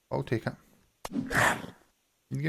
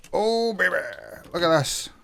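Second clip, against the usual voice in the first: excited; 6 semitones higher than usual. Real, not acted. Showing off his build.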